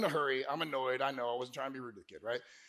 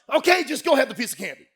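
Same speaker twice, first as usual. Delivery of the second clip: mock fed-up parent giving in, higher and louder; imitation of a worn-down mother; exasperated mom; 5 semitones higher, 5 dB louder than usual. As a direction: give in with loud exasperation.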